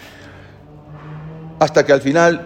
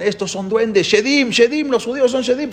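higher, frantic cry of fleeing soldiers; panicked soldiers; 10 semitones higher than usual. Retold or imitated. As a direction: shout like men running in panic. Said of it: "mientras iban corriendo decían".

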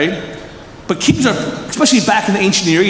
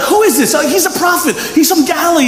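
much higher and eager; excited crowd exchange; 9 semitones higher than usual. Retold or imitated. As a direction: blurt out the news with pride. Crowd asking and answering proudly.

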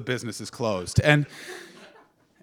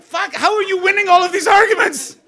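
high, loud laughing outburst; real laughing shout at an audience member; laughing exclaim; 16 dB louder than usual. Real, not acted. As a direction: crack up mid-sentence. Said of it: "You just started laughing".

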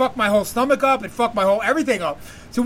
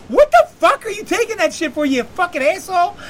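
high-pitched mock outrage, louder at the start; imitating pissed-off woman; 8 semitones higher, 4 dB louder than usual. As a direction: scold him like his furious date. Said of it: "she's, like, all pissed off".

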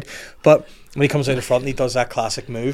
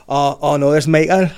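higher-pitched mock-alarmed Geordie; Geordie accent; 3 semitones higher, 5 dB louder than usual. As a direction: put on a comic Geordie cry. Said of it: "but with a Geordie accent".

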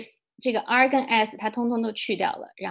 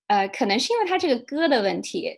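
usual speaking voice

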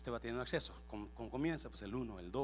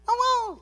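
high, loud yap; little dog's bark; 17 dB louder than usual. Acted. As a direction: yap sharply like a small dog. Little dog barks again at door. (ladrido agudo y fuerte de perrito)

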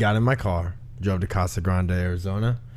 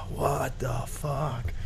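awed disbelief; 3 semitones higher, 9 dB quieter, much slower than usual. Retold or imitated. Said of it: "you're like".

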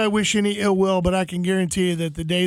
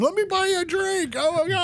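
very high, gushing imitation; mock a thrilled fan fawning; hammed-up excited squeal; starstruck fan; 11 semitones higher than usual. Retold starstruck fans.

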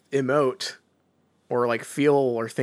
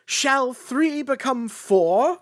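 high, slow, grand theatrical delivery; Shakespearean actor; 10 semitones higher, 4 dB louder, much slower than usual. Retold or imitated. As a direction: declaim it slowly like a ham actor. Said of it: "a new affectation that he's picked up".

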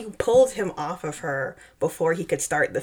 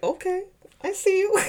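pitched way up in delight; high fangirl squeal; impressed high squeal; 14 semitones higher than usual. Retold or imitated. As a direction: squeal it like a fan. Impressed by Pattinson's moment.

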